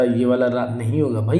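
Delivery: ordinary talk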